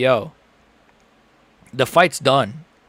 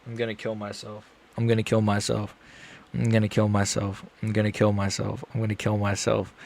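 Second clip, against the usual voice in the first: low, much softer, repeated under the breath; despairing mutter; 5 semitones lower, 10 dB quieter than usual. Real, not acted. Streamer watching his fighter lose, despairing mutter. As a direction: mumble it like you've given up.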